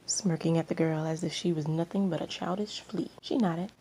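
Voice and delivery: ordinary delivery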